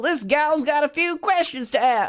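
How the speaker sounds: high-pitched Southern gal; sassy Southern accent. Acted. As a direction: call out in a high Southern twang. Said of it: "I've heard that southern accent".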